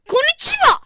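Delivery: loud, high put-on shout; mock anime greeting. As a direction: belt it like a cartoon. Japonca selam taklidi. (abartılı anime selamı)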